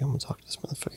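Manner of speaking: hushed private aside; whisper. Retold or imitated. Clarinetist psyching himself up, whispered to himself. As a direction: whisper it to yourself. Character's inner thought.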